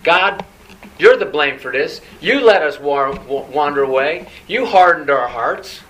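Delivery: loud, emphatic mock accusation; spoiled brat blaming. Preacher voices the people blaming God, in a loud bratty complaint. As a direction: throw the blame at God like a brat, forcefully. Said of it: "It's like a spoiled brat".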